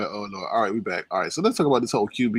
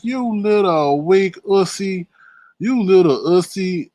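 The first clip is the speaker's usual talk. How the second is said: mocking taunt; 7 semitones higher, 5 dB louder, slower than usual. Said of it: "I'm like".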